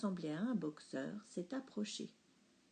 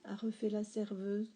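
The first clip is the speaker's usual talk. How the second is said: ordinary delivery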